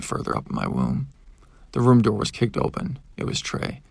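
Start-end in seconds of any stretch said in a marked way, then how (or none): none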